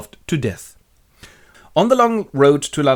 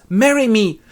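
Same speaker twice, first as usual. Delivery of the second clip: high, raised-voice proposal; ardent suitor; 10 semitones higher, 5 dB louder than usual. Acted. Young man proposes to his love, high and ardent. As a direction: propose with melodramatic urgency. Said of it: "and he goes".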